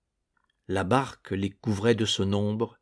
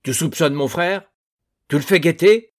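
loud, higher, angry accusing; furious accusation; 6 semitones higher, 7 dB louder than usual. A narrator's reading. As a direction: throw the suspicion at him. Doublenerre furieux contre Urbain. (Doublenerre accuse Urbain, furieux et fort)

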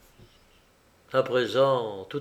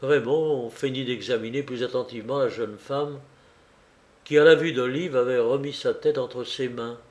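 usual speaking voice

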